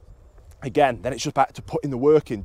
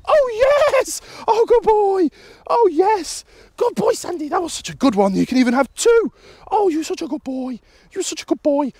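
high, thrilled squealing praise; excited dog praise; 4 dB louder than usual. Real, not acted. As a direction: gush at the dog for coming back, pitched way up. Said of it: "with vocal inflection, praise, and food".

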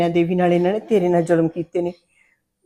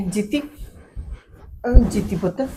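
gentle calling; 2 semitones higher, much slower than usual. Acted. Mother gently calls scared daughter.

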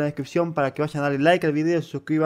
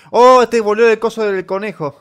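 high, loud excited outburst; excited shout; 6 semitones higher, 10 dB louder than usual. Real, not acted. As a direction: shout it with sudden thrill. Excited reaction to the cartoon. (grito emocionado y agudo)

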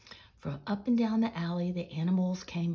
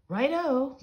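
higher and louder cheer; excited cry; 8 semitones higher, 8 dB louder than usual. Acted. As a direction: shout it gladly together. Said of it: "cried all the animals together".

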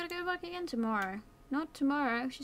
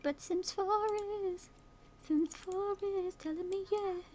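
higher, slowed sing-song, falling on yes; higher playful sing-song with a drawn yes; 8 semitones higher, slower than usual. Pretend. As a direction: sing it out like a cheeky reply. Playful answer to throat line.

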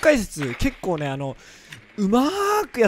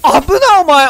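startled yelp; 10 semitones higher, 9 dB louder than usual. Real, not acted. Sudden danger in game.

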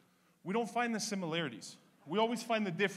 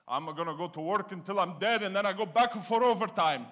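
father's motto; 2 semitones higher, 7 dB louder than usual. Retold or imitated. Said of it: "Everything was work with this guy".

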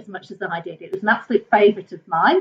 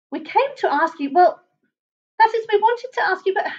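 hesitant, higher little voice; tentative, pitched-up request; faltering Basil; 10 semitones higher than usual. A narrator's reading. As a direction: stumble politely towards the question. Basil falters asking the monster.

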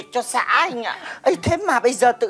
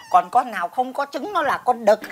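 ordinary talk

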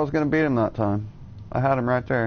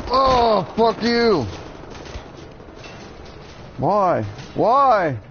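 outraged yell; 9 semitones higher, much slower than usual. Real, not acted. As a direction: howl it at the screen in disbelief. Killed from behind, outraged.

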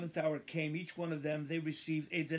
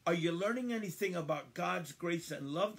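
ordinary talk, nothing marked

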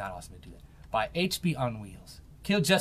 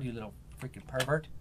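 usual speaking voice

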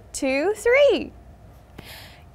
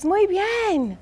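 ordinary talk

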